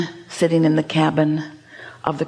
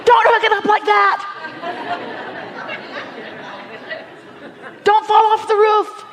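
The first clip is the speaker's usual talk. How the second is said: mock yelling; 14 semitones higher than usual. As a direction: yell frantic orders up at a roof crew, hammed up. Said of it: "standing there yelling".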